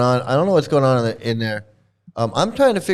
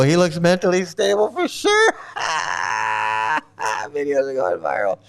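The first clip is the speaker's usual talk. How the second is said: mock agreement collapsing into a real laugh; laughing through mocking praise; sarcastic laughing; 2 semitones lower than usual. Real, not acted. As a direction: start the sarcasm, then crack up. Sarcastic remark breaking into laughter.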